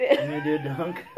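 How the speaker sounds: high squeal of laughter through the words; laughing. Real, not acted. Repeats the dunk line laughing.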